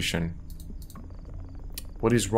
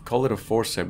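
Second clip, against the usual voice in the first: ordinary delivery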